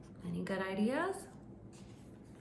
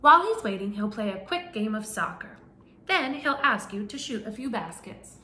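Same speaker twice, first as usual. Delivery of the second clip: animated picture-book narration; reading to children; 2 semitones higher than usual. Acted. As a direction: read it out to a class of little kids. Reads picture book to class.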